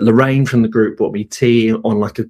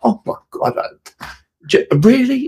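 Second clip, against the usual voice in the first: breathless and voiceless, then jumping far higher on "really?"; stunned disbelief; 13 semitones higher than usual. Retold or imitated. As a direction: gasp it, then squeak "really?". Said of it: "I was like".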